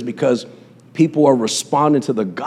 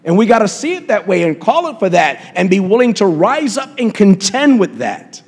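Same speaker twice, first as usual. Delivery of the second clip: preaching; 5 semitones higher than usual. Acted. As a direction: drive it home like a pulpit preacher. Preaching at full force.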